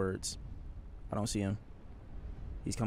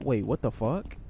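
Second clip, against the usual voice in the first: usual speaking voice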